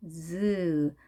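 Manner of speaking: 즈 as a long buzzed zzz; vibrating Z. Shown as a demonstration. Teacher showing it is not ㅈ, long buzzing 즈. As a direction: hold 즈 as English Z, buzzing. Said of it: "떨리는 발음을 해주셔야".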